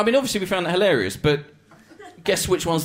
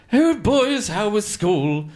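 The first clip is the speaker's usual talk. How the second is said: higher, louder then slow sing-song greeting; posh mum; 6 semitones higher, 4 dB louder, much slower than usual. Retold or imitated. Posh mum greeting the boys home from school, sing-song. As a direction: coo at the boys like a posh mother. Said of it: "She said".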